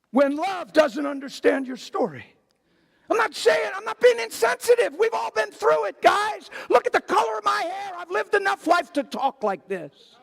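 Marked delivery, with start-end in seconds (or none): 3.1-10.1 preaching at full force. Acted. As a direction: preach it out to the whole room.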